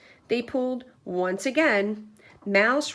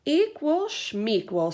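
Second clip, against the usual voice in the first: dismissive Bear; 6 semitones higher, slower than usual. A narrator's reading. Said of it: "said Bear".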